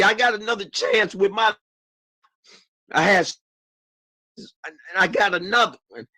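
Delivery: ordinary talk, nothing marked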